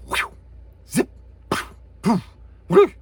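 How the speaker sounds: short, separate mouth whooshes and zips; imitated transition sounds. Shown as a demonstration. Lecturer listing shot-to-shot transition sounds, quick mouthed effects. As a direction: flick out quick swooshing effects. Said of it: "you could have".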